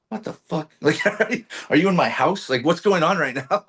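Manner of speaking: high, incredulous what-the-hell; laughing, amazed outburst; stunned disbelief. Retold or imitated. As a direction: sound like he's reading your mind. Retelling shock at friend's call.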